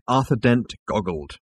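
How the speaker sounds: ordinary delivery, nothing marked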